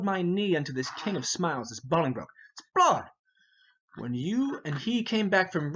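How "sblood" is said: loud, high curse; angry oath. Acted. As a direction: spit out the oath.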